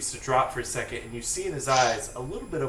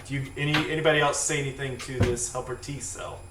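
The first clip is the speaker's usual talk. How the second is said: ordinary delivery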